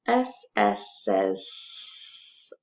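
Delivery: slow, sounded-out "S S says s"; "s" hissed slowly after the letter names. Shown as a demonstration. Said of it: "S S says s all together".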